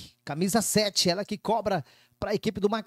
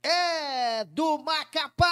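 slow, high celebratory call; explosive goal commentary; 6 semitones higher, much slower than usual. Real, not acted. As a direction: stretch it out in triumph.

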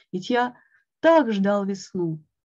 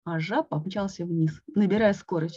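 ordinary delivery

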